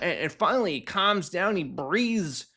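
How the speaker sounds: ordinary delivery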